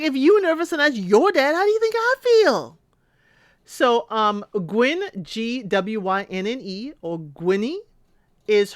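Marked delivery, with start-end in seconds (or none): 0.0-2.8 high, louder mock Billy; pitched-up imitation of Billy; imitating Billy. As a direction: squeak it out like a nervous groom. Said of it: "Billy's like".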